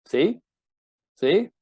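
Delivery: high, loud hurt complaint; hurt wife's reproach. Retold or imitated. Wife accusing her man, high and loud. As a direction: cry out to him like a wounded wife. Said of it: "you're hypersensitive to".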